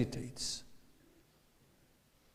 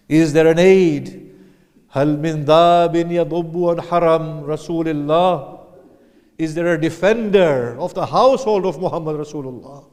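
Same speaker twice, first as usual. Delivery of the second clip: rising, louder and higher cry for a helper; 4 semitones higher, 11 dB louder than usual. A narrator's reading. Hussein calls the army for a helper, crying out louder and higher. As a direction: plead aloud, pushing higher with each call. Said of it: "Then he cries out".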